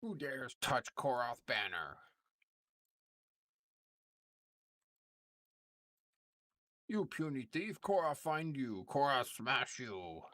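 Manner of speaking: slow, high dumb ogre growl. Acted. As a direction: threaten like a brutish ogre. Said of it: "You do a good ogre voice".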